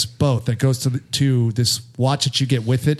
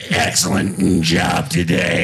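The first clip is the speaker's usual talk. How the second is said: very low, slow voice into a fan; deep, slow and breathy like Darth Vader; 7 semitones lower, much slower than usual. Pretend. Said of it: "He's doing that voice".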